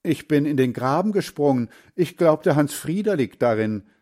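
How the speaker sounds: worked-up grandfather explaining himself; agitated explaining. A narrator's reading. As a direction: blurt out what happened. Shaken grandfather explains his jump.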